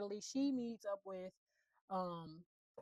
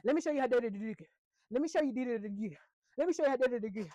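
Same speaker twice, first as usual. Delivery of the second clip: loud, higher mock Island Boy accent, said over and over; 4 semitones higher, 8 dB louder than usual. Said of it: "by his fucking tongue and his accent".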